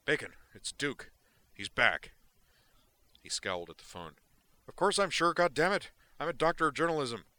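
big, wired, careless delivery; Duke's voice. Acted. Duke brushes off Zoner's question, loud and wired.

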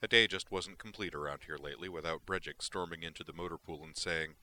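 plain reading voice

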